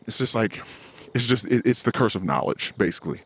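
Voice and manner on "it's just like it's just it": usual speaking voice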